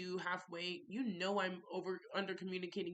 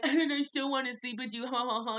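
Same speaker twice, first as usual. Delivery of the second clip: mocking imitation; 3 semitones higher, 4 dB louder than usual. Mocking his invitation to sleep together.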